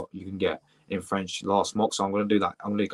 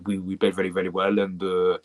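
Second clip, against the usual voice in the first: lower, drawn-out French accent with long uhs; put-on French accent; 4 semitones lower than usual. Shown as a demonstration. Streamer contrasting French speech with English, French accent. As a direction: do a leisurely French accent, lingering on fillers. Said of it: "the French is like".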